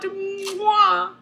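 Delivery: mock kiss noise; smacking kiss sound. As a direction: plant a big cartoon smooch.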